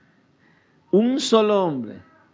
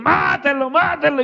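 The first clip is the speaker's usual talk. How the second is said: higher-pitched mock yell of a frenzied crowd; euphoric crowd cry; 5 semitones higher than usual. Gladiator crowd cheering on a kill, retold euphoric yell. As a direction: chant it like a bloodthirsty spectator, without full volume. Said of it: "eufóricos gritaban".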